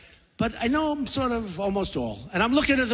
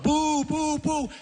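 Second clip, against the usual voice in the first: higher, playful imitation of a booing crowd; mock crowd booing; 6 semitones higher, much slower than usual. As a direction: boo like the hostile audience, half joking. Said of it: "They're booing Donald Trump".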